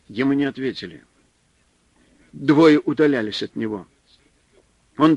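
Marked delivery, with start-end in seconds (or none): none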